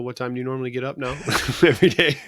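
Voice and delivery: laughing. Real, not acted. Teasing question, laughing.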